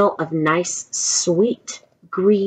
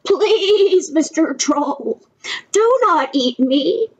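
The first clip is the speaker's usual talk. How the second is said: high, drawn-out pleading of a little goat; a little frightened; 8 semitones higher, slower than usual. A narrator's reading. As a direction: plead slowly in a high, mildly scared voice. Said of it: "was a little frightened".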